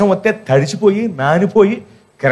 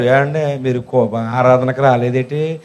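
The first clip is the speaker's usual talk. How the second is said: imitating the pastor; 8 semitones lower, slower than usual. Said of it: "pastor గారు అడుగుతారు".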